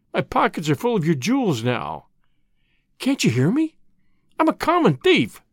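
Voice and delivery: rising in desperation to the last line; desperate plea. A narrator's reading. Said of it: "spoke thickly and incoherently".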